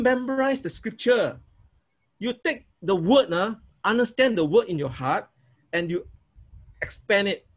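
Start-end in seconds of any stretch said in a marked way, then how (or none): none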